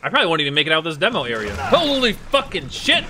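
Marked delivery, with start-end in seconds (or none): 1.6-3.0 a loud, drawn-out startled curse, rising at the end; startled outburst. Real, not acted. Jolted by a scare while playing a horror game, startled loud curse. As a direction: blurt it out slowly like the scare just hit.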